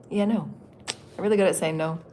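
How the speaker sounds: ordinary talk, nothing marked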